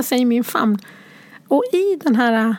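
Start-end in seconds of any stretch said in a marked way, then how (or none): none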